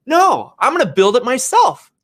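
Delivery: high, emphatic uncle voice; classic Uncle Jack. Retold or imitated. Uncle insisting he will build the house himself, high and emphatic. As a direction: declare it like it's obvious, loud and high. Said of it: "this is classic my Uncle Jack".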